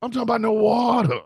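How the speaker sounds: high-pitched, hyped-up commentator imitation; Booker T. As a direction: oversell every item like an excited announcer. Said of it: "if he's yelling meat".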